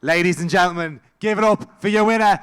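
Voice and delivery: announcer's shout. Real, not acted. Host calls crowd to applaud winner, roaring announcement. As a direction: belt out the winner's name, stretched long.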